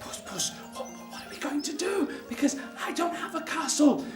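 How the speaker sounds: anxious John. Acted. John anxiously asking Puss.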